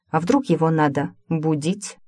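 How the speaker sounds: ordinary delivery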